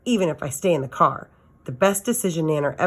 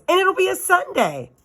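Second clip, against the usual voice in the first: high, bright ending; eager child. A narrator's reading. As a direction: pitch it up eagerly.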